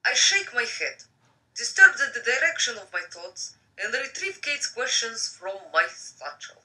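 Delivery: reading a novel aloud; story narration. Acted. Rereads passage.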